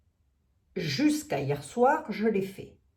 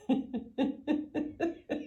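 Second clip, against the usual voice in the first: amused laugh; 8 semitones higher, 6 dB quieter than usual. Real, not acted. Laughs at viewer question.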